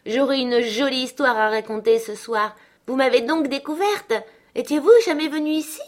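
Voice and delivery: voix de fillette haute, de plus en plus vive; fillette espiègle. Acted. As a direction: Catherine taquine sa gouvernante, enjouée. Voix de Catherine. (gamine espiègle, voix aiguë et pressée)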